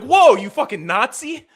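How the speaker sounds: high, loud, drawn-out shocked cry; whole bar recoiling in hammed-up horror; mock outrage. Everyone in hell reacts in shock.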